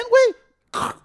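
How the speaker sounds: usual speaking voice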